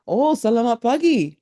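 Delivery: bright high sing-song greeting; cheerful local reply. Retold or imitated. A Balinese local greets the tourist back in bright sing-song. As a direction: chirp it back like a friendly local. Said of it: "what they'll say".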